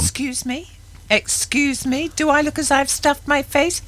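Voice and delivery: high, indignant excuse me; mock outrage. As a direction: play offended, high and incredulous. Mock outrage at being teased.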